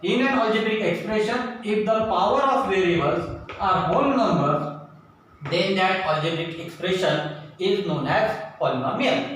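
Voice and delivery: slow, word by word, as if for notes; slow dictation. Shown as a demonstration. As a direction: dictate the definition slowly to the class. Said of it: "तो definition देखो". (धीमे-धीमे लिखवाते हुए)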